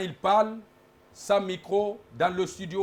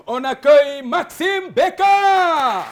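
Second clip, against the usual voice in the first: slow, drawn-out, much louder name call; announcer shout; 7 semitones higher, 10 dB louder, much slower than usual. Real, not acted. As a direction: belt out the guest's entrance. Host calls out the guest's name. (annonce criée de présentateur)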